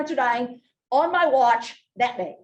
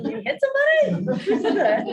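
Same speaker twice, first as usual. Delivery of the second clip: high, laughing disbelief; 14 semitones higher, 4 dB louder than usual. Real, not acted. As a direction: laugh through the questions. Laughing at audience answer.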